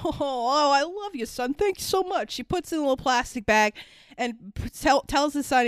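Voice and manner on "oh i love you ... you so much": high, softened mock gushing; hammed-up fatherly thanks; doting dad. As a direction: imitate a dad gushing over his son. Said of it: "But the dad".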